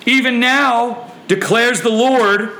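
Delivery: high, slow, ringing proclamation; scripture proclamation. Acted. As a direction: declare it slowly, high and forceful. Reads God's declaration out forcefully.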